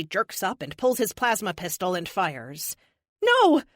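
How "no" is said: desperate scream, high and loud; shout. Acted. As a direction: scream it in horror. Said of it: "I shout".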